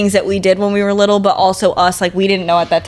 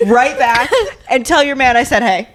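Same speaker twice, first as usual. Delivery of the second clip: cheeky, raised pitch, mock-entitled child; bratty kid's demand; 4 semitones higher than usual. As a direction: play the greedy kid bossing the Tooth Fairy. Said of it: "This is my translation of that letter".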